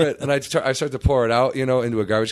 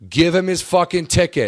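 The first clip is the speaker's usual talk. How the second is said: high, loud manager imitation; fed-up boss raising his voice; retold exasperated order; 8 semitones higher, 6 dB louder than usual. Manager turns round to the guard, exasperated loud order.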